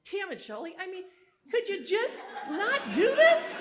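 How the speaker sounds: high, loud exasperated plea breaking into laughter; mock-pleading husband, laughing through it. Husband voiced in retold argument.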